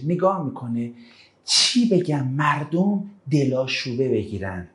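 normal talking voice

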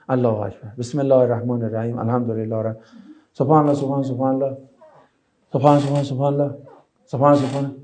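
hurried, mumbled prayer words; rushed prayer. Shown as a demonstration. Teacher imitating someone praying just before time runs out, rushed mumble. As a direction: gabble the prayer to get it over with. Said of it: "هفت هشت دقیقه مونده".

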